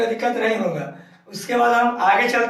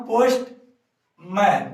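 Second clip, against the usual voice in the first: Postman drawn out slowly, every sound clear; slow clear demo; much slower than usual. Shown as a demonstration. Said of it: "क्या बोलेंगे इसका हम". (Postman को धीरे-धीरे, साफ़ बोलकर दिखाना)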